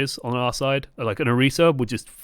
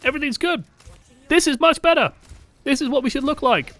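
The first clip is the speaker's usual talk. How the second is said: much higher, pumped-up commentary; excited praise; 12 semitones higher, 3 dB louder than usual. Real, not acted. Coach hyping up good Ana play, much higher and excited. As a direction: hype up what they see.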